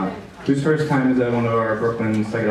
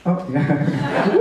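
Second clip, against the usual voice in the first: usual speaking voice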